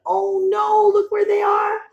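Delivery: high, pleading cry of alarm; mock panicked storyteller cry. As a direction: cry out as if they're in danger.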